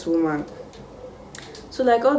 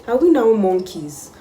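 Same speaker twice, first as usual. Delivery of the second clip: higher, louder incredulous question; indignant disbelief; 5 semitones higher, 6 dB louder than usual. Retold or imitated. Student accused of climbing trees, indignant and incredulous. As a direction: throw the absurd accusation back.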